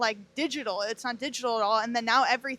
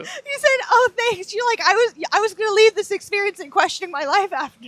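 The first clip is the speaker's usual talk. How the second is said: high, loud, laughing through words; giddy laughing mock quote; 8 semitones higher, 8 dB louder than usual. Real, not acted. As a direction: tease him while cracking up. Laughing while teasing him.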